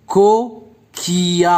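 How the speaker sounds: "Coquillage" slowly broken up, pause after the first syllable; "Coquillage" slow, in separated beats for counting. Shown as a demonstration. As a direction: split "Coquillage" into drawn-out syllables: co, qui-age. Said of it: "Donc, trois syllabes".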